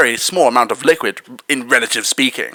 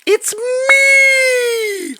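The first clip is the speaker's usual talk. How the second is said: squeaky shout. Acted. Announces himself as he appears.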